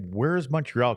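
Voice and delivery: ordinary talk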